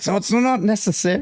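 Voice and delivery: high, mock-wounded brush-off; defensive. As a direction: act stung and refuse the sympathy. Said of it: "Get real defensive".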